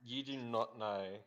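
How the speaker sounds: slow, wry. Acted. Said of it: "I'll say".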